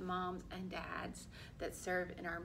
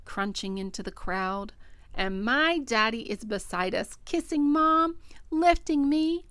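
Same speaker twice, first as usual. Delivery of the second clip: put-on expressive picture-book narration for children; story read-aloud; 17 dB louder than usual. A narrator's reading. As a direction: read it to young children, clearly projected.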